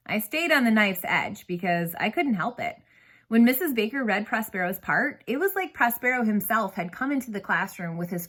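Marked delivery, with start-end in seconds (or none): none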